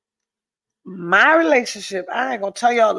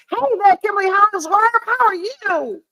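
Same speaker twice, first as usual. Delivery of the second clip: fast, high, cheery hello; bright high greeting; 10 semitones higher than usual. Real, not acted. Vlogger greets viewer Kimberly in chat, fast high cheer.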